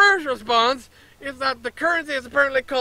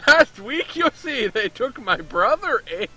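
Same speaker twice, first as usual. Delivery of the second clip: louder mock Canadian accent, leaning on brother and the closing eh; 4 dB louder, slower than usual. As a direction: ham up the Canadian merchant, eh. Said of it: "I think it sounds a bit Canadian".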